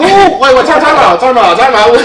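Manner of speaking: loud, high, rapid call to stop everything; frantic, waving it all to a halt; excited time out. Retold or imitated. Retelling the FaceTime news, excitedly calling time out. Retelling his shocked reaction.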